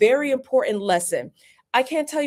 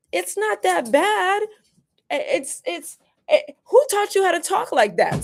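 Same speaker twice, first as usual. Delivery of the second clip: imitating her son; 10 semitones higher than usual. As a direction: play the baffled son, pitched way up. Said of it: "my son looked around in the room and he said".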